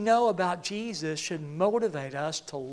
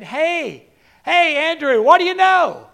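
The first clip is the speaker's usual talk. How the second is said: loud, high hail to a friend; hearty called-out greeting; 7 semitones higher, 8 dB louder than usual. Acted. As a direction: call out a greeting down the hall. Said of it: "I have a habit of saying".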